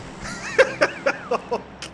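high breathy shocked laugh. Real, not acted. Startled laugh.

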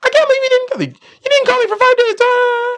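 hammed-up screeching girlfriend; imitating girlfriend yelling. Angry girlfriend yelling at him for not calling, mock shriek. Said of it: "she yells at you".

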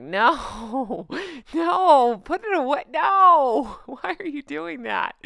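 hammed-up dismay at an awful sight; mock-horrified reaction. Retelling her reaction to the picture, mock-horrified.

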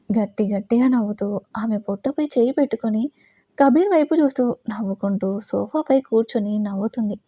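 ordinary delivery

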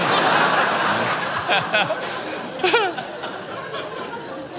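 long wheezing laugh, fading away; long breathy laugh. Real, not acted. Laughs at own joke. (langes, keuchendes Lachen, das verebbt)

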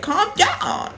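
high-pitched mock Karen; imitating an indignant Karen; Karen impression. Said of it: "that Karen that was like".

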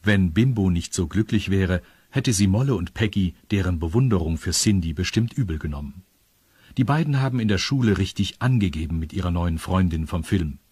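ordinary delivery, nothing marked

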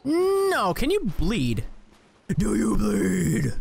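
slow, gruff mock Batman growl; hammed-up Batman growl. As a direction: play the brooding caped hero. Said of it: "talk in the Batman voice".

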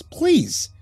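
high, desperate plea; fearful, desperate cracked voice. A narrator's reading. Husband begging wife to talk, desperate cracked voice. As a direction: plead with a cracking voice. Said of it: "fear and desperation clear in my cracked voice".

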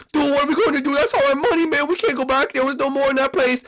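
high, loud fretting; panicked distress. Acted. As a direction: wail that all is lost, pitch way up. Despairing over stolen money.

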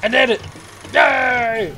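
high, loud little hooray; gleeful victory yell; triumphant cheer. Real, not acted. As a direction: celebrate a small win out loud. Celebrates a success in game.

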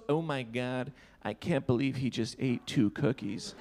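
low, softish mock disgust; rude disgust. Party girl to her friend about two cookies, rude disgust. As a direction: imitate her judging him under her breath. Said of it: "Why was she so rude".

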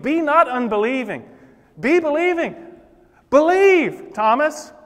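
shouting. Acted. Jesus calling out to doubting Thomas, building shout. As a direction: build to a ringing shout on "Believe". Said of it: "he had to shout those words".

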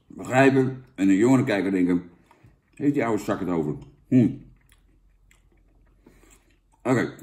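talking around a gum wad; chewing gum while talking. Real, not acted. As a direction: mouth full of gum. Seen 2: Jaw works on gum throughout; he pulls a big wad out at 18.8.

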